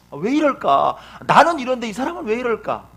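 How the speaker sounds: loud, exasperated outburst climbing high; mock anger. Preacher plays Type A angered by differing people, loud mock exasperation. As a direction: act out indignant disbelief, rising in pitch. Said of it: "막 화가 나".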